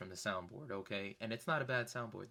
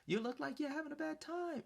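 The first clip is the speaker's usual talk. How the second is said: heckling call-out. Retold or imitated. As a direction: call out to someone on stage in a raised pitch. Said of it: "I even called him out on it".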